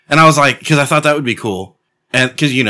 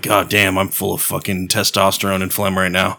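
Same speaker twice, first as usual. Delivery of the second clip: dropped low and softer; low, quieter voice of someone sick; extra low sick voice; 6 semitones lower, 5 dB quieter than usual. Acted. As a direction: sink into a congested-sounding low register. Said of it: "your voice is extra low".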